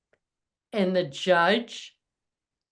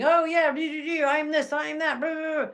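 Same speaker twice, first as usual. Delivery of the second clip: mock bragger; 8 semitones higher than usual. Mocking a bragging man.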